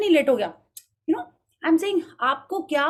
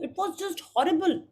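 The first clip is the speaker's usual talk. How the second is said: slow, higher, drawn-out moaning; exaggerated dramatic complaint; 5 semitones higher, much slower than usual. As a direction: stretch every word like it's a tragedy. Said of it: "ऐसे आप बात करते हो?". (बहुत नाटकीय ढंग से धीमी, ऊँची शिकायत)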